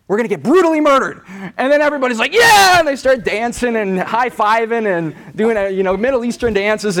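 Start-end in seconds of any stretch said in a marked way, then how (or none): 0.1-1.2 higher, louder, faster and gleeful; eager announcement. Retold or imitated. Peter tells the apostles his plan, cheerfully eager. 2.3-2.8 much higher, much louder cheer; a whooping shout of joy; cheering yell. Retold or imitated. Apostles cheer Peter's plan with a loud whoop.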